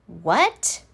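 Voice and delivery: plain reading voice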